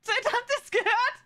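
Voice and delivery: laughing. Real, not acted. Laughing at the clip with chat.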